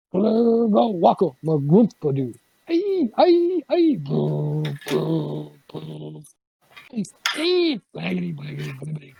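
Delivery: bouncy gibberish fading away; silly nonsense sounds. Acted. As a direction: babble nonsense for a giggle. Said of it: "make silly sounds like".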